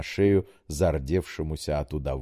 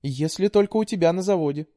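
put-on high, pleading young woman; daughter voice; 12 semitones higher, 3 dB louder than usual. A narrator's reading. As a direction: coax like a sweet daughter. Daughter voice held.

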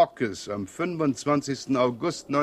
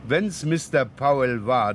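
ordinary talk, nothing marked

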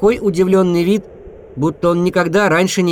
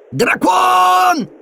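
high excited shout of alarm; 14 semitones higher than usual. Acted. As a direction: cry out the warning. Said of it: "тоже закричал бобёр".

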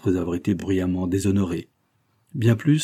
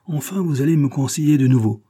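higher, louder outburst; relieved exclamation; 7 semitones higher, 5 dB louder than usual. A narrator's reading. Helper reunited with his boss, exclaiming. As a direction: exclaim with relief at having guidance. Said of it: "s'écria-t-il".

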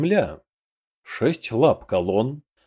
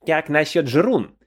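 higher goblin voice calling out; shouted suggestion; 5 semitones higher than usual. A narrator's reading. A goblin suggesting a name for the beast, calling out. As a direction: pipe up with a mild character shout. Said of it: "крикнул кто-то из гоблинов".

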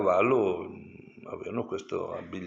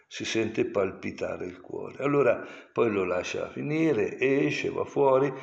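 ordinary delivery

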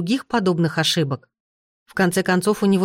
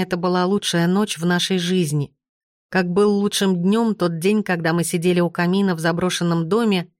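ordinary delivery

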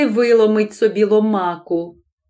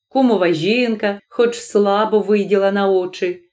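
ordinary delivery, nothing marked

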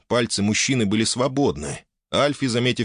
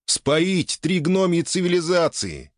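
holding back laughter; 8 semitones higher, slower than usual. Acted. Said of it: "Еле сдерживая смех".

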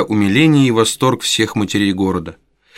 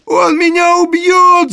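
very high, louder, slow crying scream; terrified wail; 5 dB louder, slower than usual. A narrator's reading. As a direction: howl for your life. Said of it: "завопил Ной". (истошный вопль от страха)

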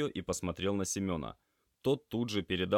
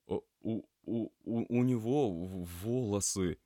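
stuttering in dismay; shocked, halting trainee; flustered stammer; 3 semitones higher than usual. A narrator's reading. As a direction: stammer helplessly, stuck on the first sounds. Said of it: "Растерянно хлопнул глазами Кирилл".

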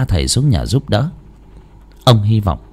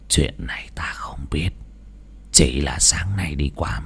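put-on old man whispering his suspicion; hushed whisper; 6 semitones lower, 10 dB quieter than usual. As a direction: keep it breathless and secret. Old man confides a secret. (thì thầm bí ẩn)

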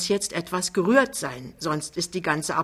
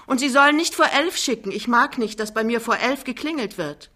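loud, higher commanding call; herrisches Nachrufen; 6 semitones higher, 6 dB louder than usual. Acted. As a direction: call the order after the son, insistently. Mutter ruft dem Sohn Anweisung nach. (laut und bestimmt nachrufen)

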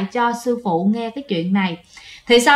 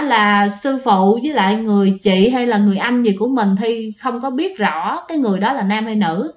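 normal talking voice

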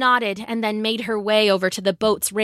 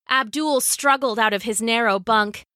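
plain reading voice

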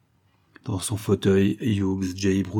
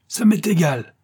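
higher-pitched defiant outburst; indignant exclamation; 5 semitones higher than usual. A narrator's reading. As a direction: exclaim it, brushing the threat aside. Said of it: "s'écria le millionnaire". (exclamation indignée)

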